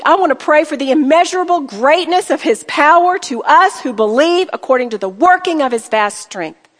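ordinary delivery

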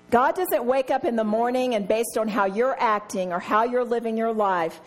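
normal talking voice

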